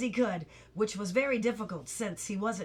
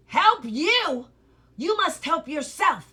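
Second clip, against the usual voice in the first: stern watchdog; 6 semitones higher, 8 dB louder than usual. Acted. Watchdog lecturing Milo.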